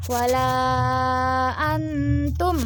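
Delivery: "Wala" stretched to five beats; five-beat mad. Shown as a demonstration. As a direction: hold the a in "Wala" for five full counts. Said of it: "Boleh juga sampai lima harokat".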